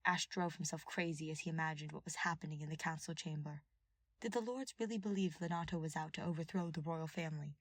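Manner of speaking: ordinary delivery, nothing marked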